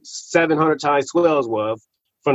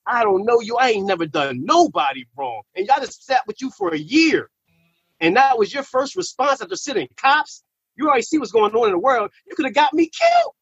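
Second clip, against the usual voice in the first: hurt confrontation; 6 semitones higher than usual. Retold or imitated. Hurt confrontation of old man.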